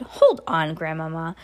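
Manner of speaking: ordinary delivery, nothing marked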